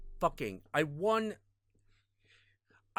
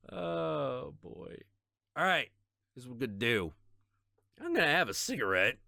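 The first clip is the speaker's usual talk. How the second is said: ordinary delivery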